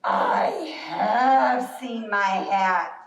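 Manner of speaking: very slow, low, drawn-out bear; bear's sudden realisation. Acted. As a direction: stretch each word as the bear realises. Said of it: "so Bear says".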